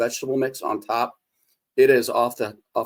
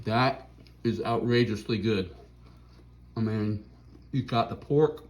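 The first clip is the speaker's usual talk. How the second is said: mouth full; 4 dB quieter than usual. Real, not acted. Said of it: "talk with your mouth full".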